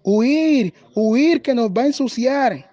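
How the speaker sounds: high storybook cry of alarm; frightened goats. A narrator's reading. Goats startled on the road, crying out in fright. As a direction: call the alarm high, stretching the verbs. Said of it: "se asustaron".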